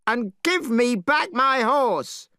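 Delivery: Monkey waving his cudgel; battle shout. Acted. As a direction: dare him to fight, full voice. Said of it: "shouted Monkey".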